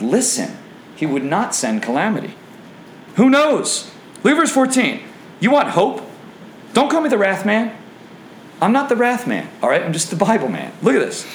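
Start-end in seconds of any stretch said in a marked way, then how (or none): 3.2-9.5 high-pitched, urgent burst of hope; excited preaching. Real, not acted. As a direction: call it out high and insistent.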